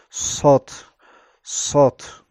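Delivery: "سات" with a heavy s, unlike the s of sin; loud, isolated "سات" with a darkened s; emphatic S. Shown as a demonstration. Teacher showing how it differs from sin, emphatic "سات".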